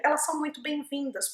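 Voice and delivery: normal talking voice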